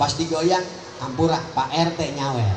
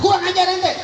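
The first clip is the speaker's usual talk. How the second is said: much higher, louder scolding; angry angel; 14 semitones higher, 4 dB louder than usual. Retold or imitated. Angry angel scolds the singing dead man, furious. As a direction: snap it at the insolent man. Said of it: "kata malaikat".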